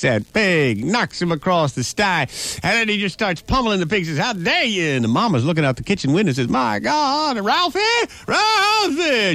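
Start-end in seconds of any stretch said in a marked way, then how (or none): 4.2-4.9 mock outraged boy. Boy beats pig, acted line. 6.5-9.3 high, shrill mother calling her son; shrieking mama. Acted. Mama shouts from kitchen window.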